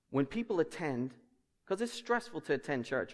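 ordinary talk

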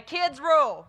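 put-on loud, punchy "Kids rule" cheer; rallying cheer. Shown as a demonstration. Leading kids to tell the grown-ups, loud rallying cheer. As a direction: call it out big for the children to echo. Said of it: "turn around, look at the grown-ups, and say".

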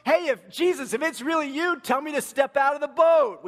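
loud, high call across water; acted shout out to someone far off; speaking up. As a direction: pipe up boldly, high and loud. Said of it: "he speaks up".

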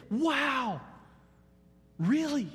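put-on high-pitched childlike amazement; awed like a kid. Preacher recalling his week of study, awed like a kid. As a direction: gasp out wonder like a thrilled kid. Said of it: "I felt like a kid".

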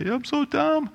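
high whiny mock self-pity; mock crying. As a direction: whimper it like a fake crybaby. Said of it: "start crying and say".

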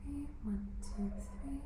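breathy whispered counting. Acted. Boy counting waltz steps while dancing, whispered. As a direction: count under your breath.

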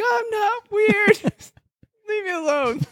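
put-on high, cracking whine of an offended old man; whiny old man. Old man whining back at mocking high school kids. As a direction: whimper like a hurt grandpa shooing teens away.

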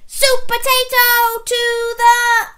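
high, loud, drawn-out fanfare; slow triumphant hero cry; heroic rescue call. A narrator's reading. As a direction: proclaim the hero's arrival.

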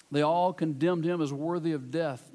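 usual speaking voice

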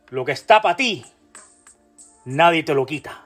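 normal talking voice

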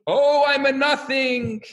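drawn-out, high sing-song wail; hammed-up chant held on one high note; mock wailing cry. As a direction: wail it long and high, imitating a student's lament. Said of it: "And the other one's screaming".

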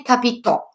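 capitò with the stress on the final ò; final-syllable stress on capitò; stress on the last syllable. Shown as a demonstration. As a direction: land hard on the end: capi-TÒ. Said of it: "L'accento può cadere sull'ultima sillaba".

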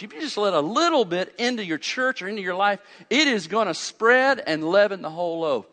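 loud, high, building sermon delivery; fired-up preaching. Acted. Pastor rebuking the Galatians from the pulpit, preaching at full force. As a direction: preach it hard at the crowd.

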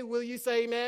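ordinary talk

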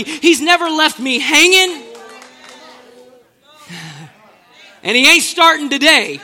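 loud, driving sermon voice; full-force preaching. Acted. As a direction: preach it out with fire to the congregation.

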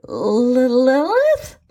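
struggling to get the name out; sputtered. A narrator's reading. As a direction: sputter it out as an unsure question. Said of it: "sort of sputtered".

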